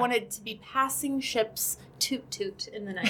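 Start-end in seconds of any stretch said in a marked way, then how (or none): 2.0-3.1 soft, playful toot toot; tiny imitated horn, much softer; little ship horn. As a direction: toot it quietly like a distant boat. Playful imitation of passing ships.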